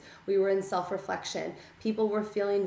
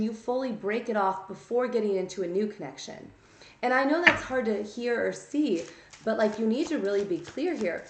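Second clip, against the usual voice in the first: ordinary talk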